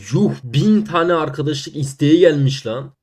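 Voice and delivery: loud and slow disbelief; shocked shout. Real, not acted. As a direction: blurt it out in amazement. Shocked at friend requests.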